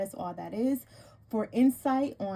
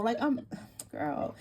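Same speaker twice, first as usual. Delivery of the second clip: ordinary delivery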